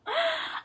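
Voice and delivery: 带哭腔的吸气; emotional gasp. Real, not acted. Overcome with emotion.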